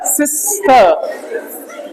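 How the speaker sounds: सिस्टर drawn out slowly with a long hissed s; sounded-out word. Shown as a demonstration. Teacher building the word from letter sounds, slow sounded-out सिस्टर. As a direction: stretch सिस्टर sound by sound, holding the s. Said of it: "यहीं से बनेगा एस I एस T E R".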